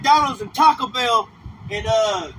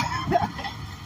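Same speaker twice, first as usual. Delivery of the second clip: a quick airy laugh breaking through; breathy laugh; 9 dB quieter than usual. Real, not acted. Preacher laughing at his own jab at the crowd, breathy laugh. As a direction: laugh under the breath at the joke.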